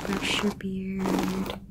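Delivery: ordinary delivery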